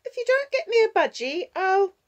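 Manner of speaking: put-on very high, loud little-girl threat; threatening child. Six-year-old reaching for a threat, threatening child voice. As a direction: threaten as a small child in a tantrum.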